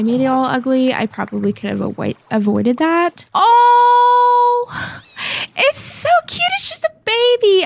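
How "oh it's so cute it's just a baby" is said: very high, drawn-out adoring squeal; cooing squeal. Real, not acted. Delighted by a baby axolotl.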